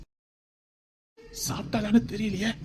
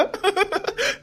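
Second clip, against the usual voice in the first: joyful laugh; 10 semitones higher than usual. Acted. Overjoyed new father.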